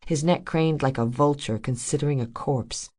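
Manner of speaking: plain reading voice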